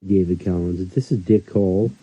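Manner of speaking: usual speaking voice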